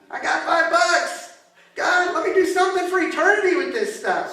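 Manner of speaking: loud, high-pitched sermon climax; urgent shouted pleading to a congregation; impassioned preaching. Acted. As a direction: cry out the prayers at full force. Preaches at full force.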